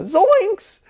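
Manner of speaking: very high, loud cartoon yelp; bad Shaggy catchphrase. Movie fan attempting Shaggy's catchphrase for viewers, horrible squeaky impression. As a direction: squeal it like a badly imitated cartoon coward. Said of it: "that was a horrible impression".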